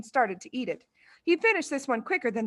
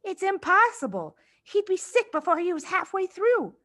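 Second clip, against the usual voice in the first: put-on girl's voice; 9 semitones higher than usual. A narrator's reading. Lavender speaking to Matilda.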